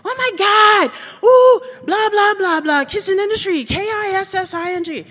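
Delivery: put-on very high, loud squealing teen voice; shrill mocking girl squeal; mock valley girl kids. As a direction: squeal it like gossiping schoolgirls.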